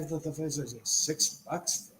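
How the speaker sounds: retold under-the-breath incredulity; low-volume, nearly whispered grumble; muttered disbelief. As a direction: mutter it softly to yourself, baffled. Retells own disbelief at online price.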